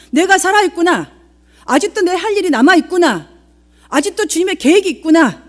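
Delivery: high, loud declaration of hope; triumphant realization. Acted. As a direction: proclaim each line as Paul's rising conviction. Voicing Paul's hope amid suffering.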